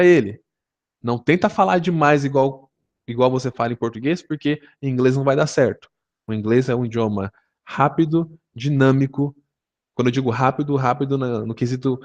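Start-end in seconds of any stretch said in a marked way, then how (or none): none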